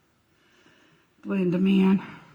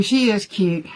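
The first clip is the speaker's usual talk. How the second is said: ordinary talk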